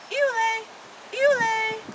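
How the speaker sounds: high gentle thrush imitation; wood thrush song. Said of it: "Wood thrush turns the twilight still".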